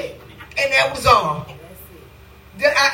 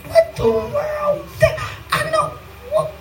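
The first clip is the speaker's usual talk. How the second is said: stretched, slow, high amazed cry; what the world, drawn out in disbelief; high astonished wail to the Lord; baffled cry; slower than usual. Retold or imitated.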